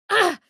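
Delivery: high, loud sharp cry of pain; a jolt of sudden pain. Acted. Companion struck by the tadpole's pull, sharp pained cry.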